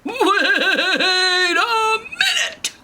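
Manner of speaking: high, loud, long wobbling cry; hugely drawn-out comic alarm; outraged Papa Bear. Acted. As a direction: stretch it into an indignant bellow. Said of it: "all of a sudden you hear".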